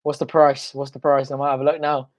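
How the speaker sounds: normal talking voice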